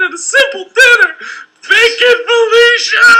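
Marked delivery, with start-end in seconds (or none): 1.7-3.1 slow, loud dramatic cry; dramatic shout. Acted. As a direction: cry the name out slowly. Dramatic outcry in game line.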